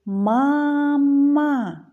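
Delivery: «мама» stretched into two long loud syllables; drawn-out syllables. Shown as a demonstration. Teacher asks children to count syllables, «мама» stretched out. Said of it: "Скільки складів у слові".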